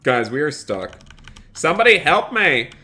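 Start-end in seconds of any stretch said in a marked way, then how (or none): 1.5-2.7 loud, high call for help; mock cry for help. Stuck streamer begging someone to help, hammed-up cry. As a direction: shout for help, playing it up.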